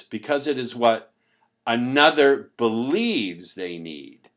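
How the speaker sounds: "another believes they need" said slow and loud, leaning on "believes"; stress on believes. Shown as a demonstration. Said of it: "let me underline the word believe".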